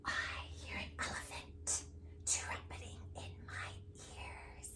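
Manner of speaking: very soft breathy whisper. Acted. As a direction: hush the line down to a whisper. Reading the elephant page.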